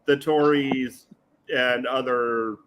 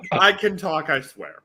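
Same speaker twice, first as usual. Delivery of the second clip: words bubbling through a real laugh, the start pitched higher and louder; 6 semitones higher, 4 dB louder than usual. Real, not acted. Caught misspeaking, laughing to the co-hosts. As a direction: laugh while insisting you can talk. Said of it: "I love how you nearly said".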